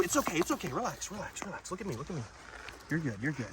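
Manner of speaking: soft soothing murmur; calm the lizard gently; soothing a scared dog. Real, not acted. Calming the lizard.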